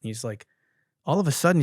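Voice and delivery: ordinary talk, nothing marked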